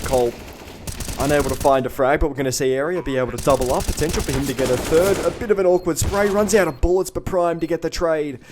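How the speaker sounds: rising play-by-play hype; excited. Real, not acted. Live multi-kill call rising.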